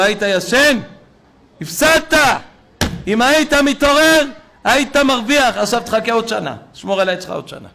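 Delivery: loud, high-pitched incredulous rebuke, easing at the end; acted scolding. Professor to the father who slept through it, scolding loudly. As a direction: let him have it for oversleeping, in the professor's role.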